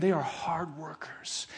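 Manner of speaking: slow whispered aside; hushed admiring whisper. Real, not acted. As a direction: lean in and whisper it with respect. Admiring aside about missionaries.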